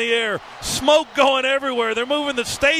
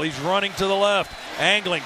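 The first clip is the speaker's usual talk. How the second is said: usual speaking voice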